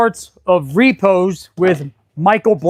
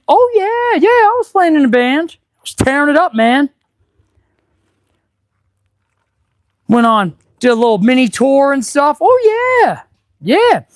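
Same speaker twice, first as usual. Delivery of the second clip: excited bragging; 6 semitones higher than usual. Real, not acted. Bragging about his band days.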